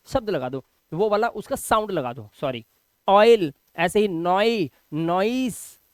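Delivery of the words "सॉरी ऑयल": normal talking voice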